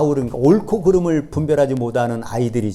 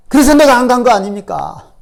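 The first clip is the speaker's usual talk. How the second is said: Jonah's complaint, higher and louder; indignant prophet's outburst; angry complaint; 9 semitones higher, 8 dB louder than usual. Acted. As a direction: throw the grievance up at God. Jonah defends himself to God.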